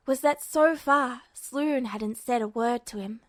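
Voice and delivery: plain reading voice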